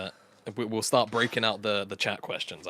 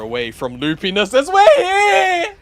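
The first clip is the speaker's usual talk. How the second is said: excited shout; 13 dB louder, slower than usual. Real, not acted. Hyped cast returning after delay.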